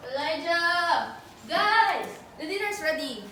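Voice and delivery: drawn-out call across the house; calling kids to dinner. Acted.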